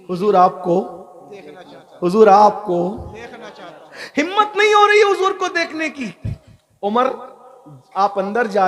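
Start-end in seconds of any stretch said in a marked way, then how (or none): 1.3-2.0 breathy longing whisper. Shown as a demonstration. The weeping man longing to see the Prophet, whispered. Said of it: "आपकी तवज्जो है ना?". 2.0-6.1 high, strained longing; yearning cry. Acted. The man at the door begging to see the Prophet, high cry.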